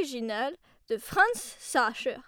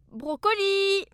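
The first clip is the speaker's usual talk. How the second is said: calling out; 6 semitones higher, 6 dB louder, slower than usual. Acted. Calling dog in cellar.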